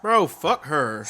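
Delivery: loud, slow, disgusted; blunt outburst. Real, not acted. Reacting angrily to the clip.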